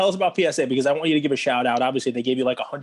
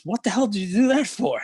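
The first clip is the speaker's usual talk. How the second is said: higher-pitched mock outrage; indignant demand; 7 semitones higher, 4 dB louder than usual. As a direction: act out an indignant joke character. Knocked-down man demands explanation in joke.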